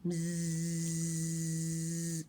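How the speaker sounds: long steady bee buzz. Acted. Bee sound after story.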